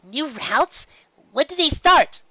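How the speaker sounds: can't wait to know; eager questions. A narrator's reading. Mailmare eagerly asking about her new routes, rising high.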